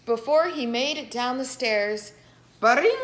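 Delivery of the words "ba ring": loud, high ba-ring; phone ring. Acted. The phone rang.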